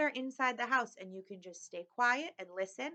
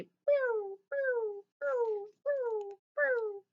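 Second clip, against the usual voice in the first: high cooing pigeon voice; much slower than usual. A narrator's reading. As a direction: coo it high like hungry pigeons. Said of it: "They say".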